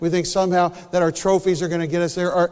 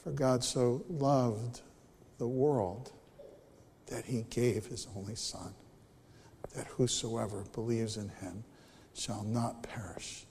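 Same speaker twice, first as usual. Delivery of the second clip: hushed closing; 9 semitones lower, 13 dB quieter than usual. Real, not acted. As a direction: let it die into a whisper. Sermon closes in whisper.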